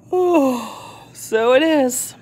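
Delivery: slow sleepy yawn. A narrator's reading. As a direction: yawn through it slowly. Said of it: "yawned Missus Tortoise".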